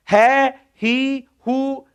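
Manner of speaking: है, ही, हु each said slowly and apart; teacher contrasting the visarga echoes; slow sound demo. Shown as a demonstration. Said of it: "वही स्वर लग रहा है".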